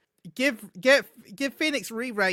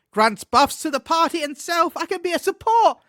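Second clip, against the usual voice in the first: excited; 10 semitones higher, 6 dB louder than usual. Real, not acted. Thrilled about support role.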